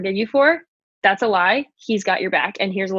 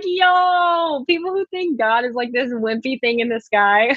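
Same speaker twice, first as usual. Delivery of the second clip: high, amused "Yo" starting well above normal and easing down; tossing it at the doubters with a laugh in the voice; incredulous retort; 3 semitones higher, slower than usual. Retold or imitated. Mocking people who call God wimpy, high and amused. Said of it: "I'm like".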